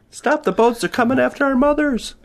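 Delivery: high-pitched, hammed-up alarm; mock panic. Player warning that boats are coming, mock panicked. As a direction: cry out a warning in pretend panic.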